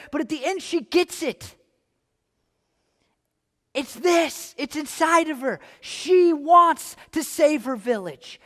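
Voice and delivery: rally the congregation with rising passion; preaching at full force. Real, not acted. Preaching climax.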